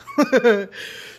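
short laugh; brief real laugh. Real, not acted. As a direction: let a laugh out. Laughs at herself.

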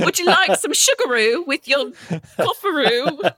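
put-on high-pitched silly British accent, half laughing; hammed-up British accent, offering tea-time nonsense; mock British accent. Riffing on the dumb Deliveroo name, mock British accent. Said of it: "That is the most British thing".

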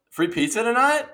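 overjoyed at free pizza; acting out a thrilled phone call; hammed-up excitement.

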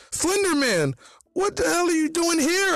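very high, shrieked question; startled yell. A narrator's reading. Ben startled by Slenderman's arrival, high-pitched yell. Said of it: "I yelled".

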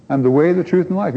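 "way", "truth" and "life" in one flowing run, no pauses; run-together restatement. Retold or imitated. Preacher recapping for listeners, "way", "truth", "life" run together. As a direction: restate it briskly straight through to "life".

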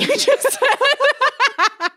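high, slow laughing retraction. Real, not acted. Retracting her idea, laughing.

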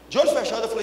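ordinary delivery

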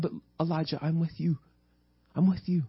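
soft, hushed comfort; gentle whisper-like reassurance. Acted. God telling Elijah he is with him, gently hushed. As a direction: drop to a tender near-murmur. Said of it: "he wants to whisper that to you".

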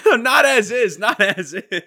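high, loud protest; loud laughing protest. Real, not acted. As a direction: shout it through laughter. Defending joke loudly.

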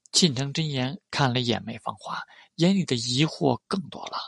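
usual speaking voice

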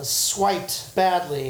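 ordinary delivery

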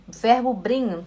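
ordinary delivery

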